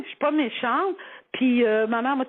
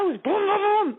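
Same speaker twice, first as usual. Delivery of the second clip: high vocal vroom of a car; imitated engine revving; 8 semitones higher, much slower than usual. Driver hitting the gas while braking, imitated engine noise. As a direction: rev it up with your voice.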